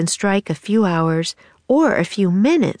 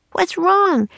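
put-on much higher girl's voice, worried; worried cry; 13 semitones higher than usual. A narrator's reading. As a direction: call out to her brother in alarm. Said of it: "Annie cried".

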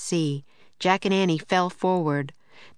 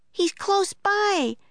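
put-on high, drawn-out girl's call; Annie's voice; slower than usual. A narrator's reading. Annie following the barking, high slow call. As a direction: stretch it out, pitched high.

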